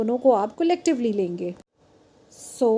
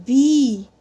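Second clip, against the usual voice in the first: "B" louder, its ee stretched out; long, loud vowel; 6 dB louder than usual. Shown as a demonstration. Teacher demonstrating an open E, "B" long and loud. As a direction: hold the ee in "B" strongly. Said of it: "E is open and it is loud".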